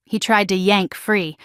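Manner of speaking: plain reading voice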